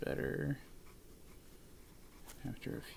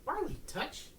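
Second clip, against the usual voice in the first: high dog cue; 12 semitones higher, 4 dB quieter, slower than usual. Real, not acted. Calling dog to do touch.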